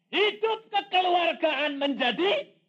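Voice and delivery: slow, high and loud proclamation; fervent sermon climax; emphatic preaching. Acted. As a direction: drive the point home.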